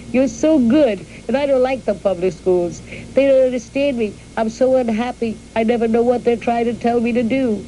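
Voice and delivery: put-on pleading little girl. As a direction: beg to stay like an unhappy small girl.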